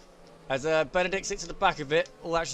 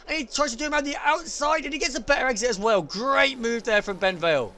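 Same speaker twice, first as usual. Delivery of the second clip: higher, rising race commentary; live play-by-play; 7 semitones higher, 3 dB louder than usual. Acted. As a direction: call the overtake live, building.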